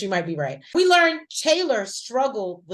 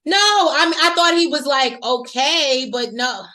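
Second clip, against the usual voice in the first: excited; 6 semitones higher, 8 dB louder than usual. Real, not acted. Excited outburst about the cast.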